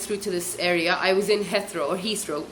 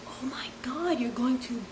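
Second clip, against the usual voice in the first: hushed gasp, breathy and unvoiced; whispering; 3 semitones higher, 9 dB quieter than usual. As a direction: whisper it like gossip.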